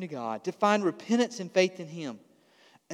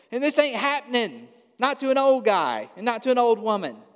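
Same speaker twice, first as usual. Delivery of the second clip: put-on higher, incredulous old man's voice; scoffing old man; 6 semitones higher than usual. Old priest brushing off the angel, scoffing. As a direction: play the skeptic who knows better.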